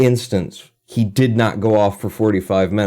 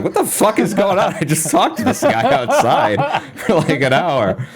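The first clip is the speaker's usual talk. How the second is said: higher, bewildered professor voice with a laugh; baffled professor; 10 semitones higher than usual. Retold or imitated. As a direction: imitate the confused professor. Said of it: "And he'd be like".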